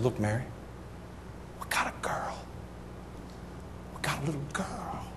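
near-whispered, awed news to a wife; tender hush. Retold or imitated. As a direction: share it very softly, almost whispering. Telling wife about newborn girl.

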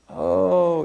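a slow-witted, vacant "oh"; impaired man's speech. Retold or imitated. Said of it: "he talks like this".

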